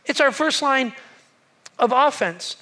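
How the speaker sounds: ordinary delivery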